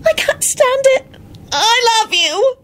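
high, slow crying wail; sobbing wail. A narrator's reading. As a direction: sob out your love.

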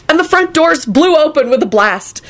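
ordinary delivery

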